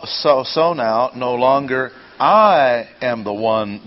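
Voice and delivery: I long and loud, standing out; slow down and hit I hard; heavy emphasis on I; stress on I. Shown as a demonstration. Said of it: "Emphasis on the word I".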